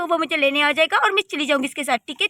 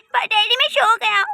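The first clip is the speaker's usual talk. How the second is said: put-on higher and louder, a little girl whining to daddy; child voice; 6 semitones higher, 5 dB louder than usual. Daughter whines to her daddy, childlike and raised. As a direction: play the sleepy small daughter.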